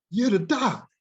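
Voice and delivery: retold loud, high exclamation; exclaiming. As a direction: blurt it out high and loud to your teacher. Retold exclamation to his teacher.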